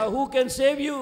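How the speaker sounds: loud, high preaching cry; fiery preaching. Acted. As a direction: thunder it at the congregation. Preaching at full force.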